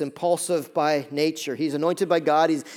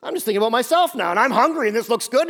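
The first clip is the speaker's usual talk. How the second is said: quick, higher, self-absorbed inner voice; selfish Samson; 5 semitones higher, 4 dB louder, faster than usual. Retold or imitated. Preacher voicing Samson's selfish thoughts, quick and higher. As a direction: rattle it off like a greedy man with only himself in mind. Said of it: "All he's thinking about is who?".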